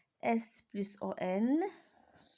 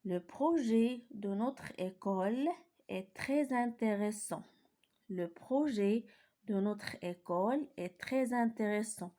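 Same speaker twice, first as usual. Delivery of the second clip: "très intéressant" read slowly and clearly; slow dictation reading; slow model sentence reading; slower than usual. Shown as a demonstration. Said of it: "Je lis les phrases".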